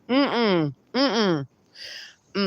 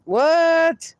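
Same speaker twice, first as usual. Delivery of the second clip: sharp, much higher disbelieving what; shocked what; 8 semitones higher than usual. Real, not acted. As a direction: catch yourself off guard. Reacts to a hundred percent.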